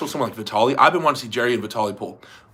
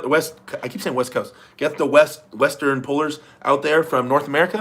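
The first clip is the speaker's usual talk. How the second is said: usual speaking voice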